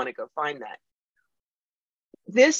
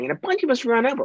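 ordinary delivery

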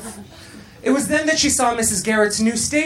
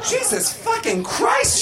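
high, drawn-out shocked cry; shouting; 14 semitones higher, slower than usual. A narrator's reading. Blair reacts to Tootie's acid trip, shouting. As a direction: cry the oath out slowly, very high. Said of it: "shouted Blair".

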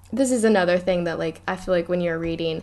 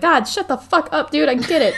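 annoyed Harry; 7 semitones higher than usual. Retold or imitated. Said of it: "he's like".

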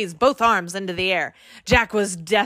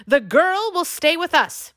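shouting king; 8 semitones higher than usual. A narrator's reading. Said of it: "the High King shouted".

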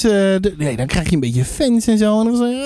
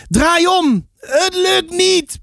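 high, strained storybook yell without much volume; narrated roar; 7 semitones higher, much slower than usual. A narrator's reading. Brothers calling to each other in the waves, acted roar. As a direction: yell back in a raised pitch. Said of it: "brulde Sam terug".

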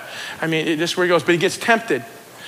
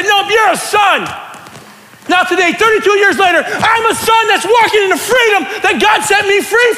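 raised, emphatic testimony; full-force preaching; 8 semitones higher than usual. Real, not acted. Preacher offering freedom to the church, full-force preaching. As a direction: proclaim freedom with conviction.

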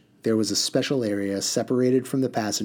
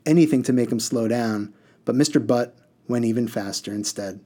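plain reading voice

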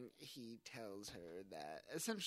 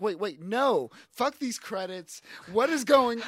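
higher and much louder, panicked; frantic mock yell; 10 semitones higher, 19 dB louder than usual. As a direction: yell it like a man who just noticed the credits rolling. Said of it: "he's just like".

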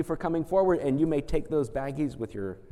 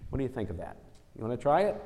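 ordinary talk, nothing marked

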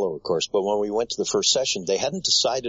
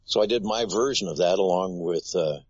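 usual speaking voice

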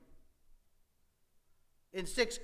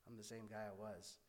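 much softer, wounded reply kept to himself; hurt inner thought; 8 semitones lower, 15 dB quieter than usual. Retold or imitated. Inner answer to classmates who only like him for soccer, soft and hurt. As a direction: say it under your breath, still stung.

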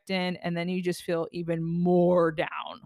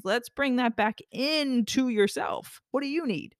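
gentle guiding repeat; 4 semitones higher than usual. Shown as a demonstration. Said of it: "I'm doing the gesture with my hands".

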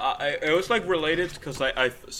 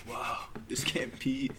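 soft breathy whisper, mock awe; 10 dB quieter, slower than usual. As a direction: whisper it in disbelief.

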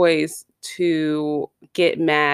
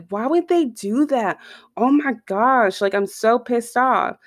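pitched up mock rant at another driver; a hammed-up fuming driver, higher all through; imitating angry driver; 6 semitones higher than usual. Driver ranting at slow car.

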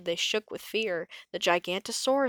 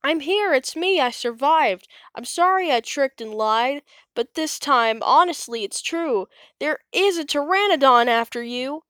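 Bonehead crying out; 6 semitones higher, 6 dB louder than usual. A narrator's reading. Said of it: "a muffled cry".

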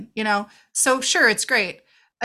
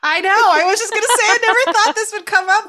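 high, loud, excited squeal of disbelief; 12 semitones higher, 8 dB louder than usual. Real, not acted. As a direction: burst out with a high gleeful cry.

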